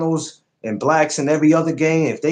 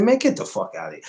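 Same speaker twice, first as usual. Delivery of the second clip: dismissive; 12 semitones higher, 4 dB quieter, faster than usual. Retold or imitated. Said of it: "I said".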